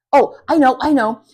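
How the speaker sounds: loud, eager realisation; excited. Acted. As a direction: burst out with the idea. Girl gets an idea.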